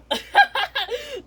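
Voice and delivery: high-pitched acted giggle; bright burst of high laughter; giggling. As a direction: laugh gleefully at someone's mishap. Laughs at squirted Daddy Pig.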